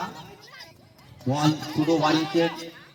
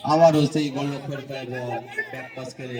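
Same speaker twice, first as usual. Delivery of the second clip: normal talking voice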